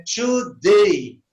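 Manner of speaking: "today" slowed, its ending a clear "ay"; A pronounced ei. Shown as a demonstration. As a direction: model "today" slowly, stretching the final ay. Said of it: "tá vendo que você pronuncia E?". ("today" devagar, com o "a" soando "ei")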